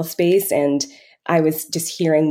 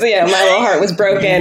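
higher, louder, hammed-up hurt; mock heartbreak; 4 semitones higher, 7 dB louder than usual. Telling the interviewer about a hurtful call, hammed-up heartbreak. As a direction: play up a wounded little heart, half joking. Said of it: "my feelings got hurt".

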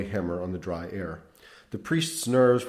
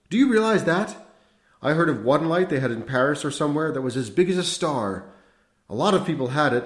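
higher and louder, wondering and feverish; excited cry; 6 semitones higher, 6 dB louder than usual. A narrator's reading. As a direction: marvel aloud to the boy. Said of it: "he cried".

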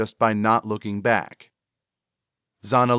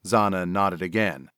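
plain reading voice

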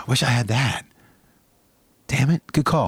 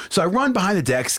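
ordinary talk

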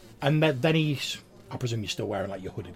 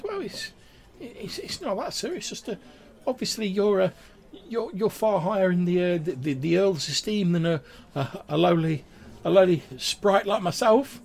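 high, humble stammering sprite, growing louder; Grimwald, squeaky; 9 semitones higher, 5 dB louder than usual. Acted. Lowly sprite defers to a higher guest, squeaky and halting. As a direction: grovel nervously in a high voice. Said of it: "in, like, a really, like, squeaky, high-pitched voice".

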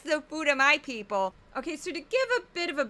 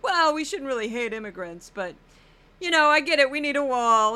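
Geraldo paraphrase; 2 semitones higher, 7 dB louder than usual. Retold or imitated. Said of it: "He'd be a little like".

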